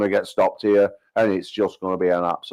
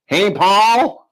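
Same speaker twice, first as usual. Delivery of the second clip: high, loud American holler; bad American accent; 11 semitones higher, 6 dB louder than usual. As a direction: imitate an American calling out, pitched way up. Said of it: "I'm doing a bad American accent".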